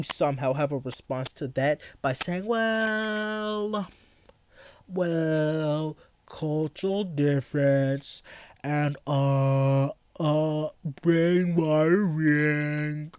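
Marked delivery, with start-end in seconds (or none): 2.5-13.1 slow, dopey mock voice dragging every word; a slowed, dopey parody of the opponent; mocking voice of the other guy. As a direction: play him as a slow-witted fool. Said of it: "I'm voicing you. This is how you sound to me".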